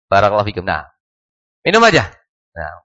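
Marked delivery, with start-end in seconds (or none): 1.6-2.1 curt, higher-pitched threat; angry dare. Shown as a demonstration. Teacher mimicking someone forbidding by permitting, angrily daring. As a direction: permission meant as a warning. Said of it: "marah gitu".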